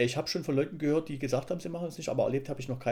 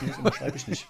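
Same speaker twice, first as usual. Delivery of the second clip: laughing refusal; 3 dB louder than usual. Retold or imitated. Retelling refusal to superiors.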